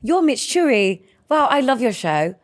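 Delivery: high, gushing Essex fan; Essex accent. Acted. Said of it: "a bit more like Essex".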